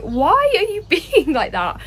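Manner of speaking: high, drawn-out baffled question with a laugh; slow, incredulous retort; taken aback. Retold or imitated. Tourists reacting to a standoffish Brit, taken aback. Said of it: "we were taken aback".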